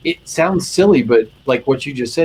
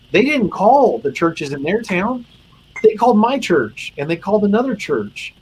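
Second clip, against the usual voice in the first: high, emotion-laden voice; emotional; 8 semitones higher than usual. Real, not acted. Chaplain telling how officers called his church for help, emotional. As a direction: push through rising emotion as you tell it. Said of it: "I made it more emotional than I meant to".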